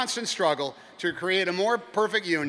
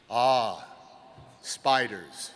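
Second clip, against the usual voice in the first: put-on wise old sage; 4 semitones lower, much slower than usual. Wise man muses.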